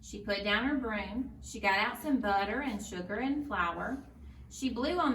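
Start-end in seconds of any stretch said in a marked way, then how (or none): none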